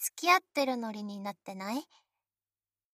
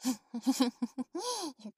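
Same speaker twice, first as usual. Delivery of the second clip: embarrassed laugh; 2 semitones higher than usual. Real, not acted. Laughs at own imitation.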